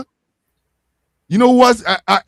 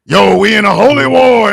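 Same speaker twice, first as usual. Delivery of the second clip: loud, hyped-up soldier quoting; mock soldier voice; 8 dB louder than usual. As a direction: hype it up like a grunt. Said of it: "saying that they were being told".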